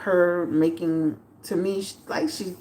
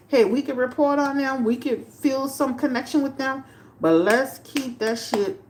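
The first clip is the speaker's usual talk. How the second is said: usual speaking voice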